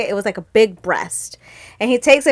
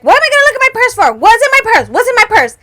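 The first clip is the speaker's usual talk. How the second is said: high, fast, louder baffled complaint; annoyed mom; 11 semitones higher, 5 dB louder, faster than usual. Retold or imitated. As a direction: demand to know what's going on. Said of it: "she's like".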